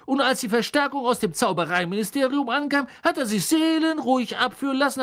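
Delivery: very high, loud, gleeful horror; shrill excited gossip. Acted. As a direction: relish every shocking detail.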